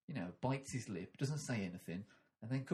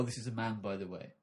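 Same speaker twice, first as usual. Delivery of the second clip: ordinary talk, nothing marked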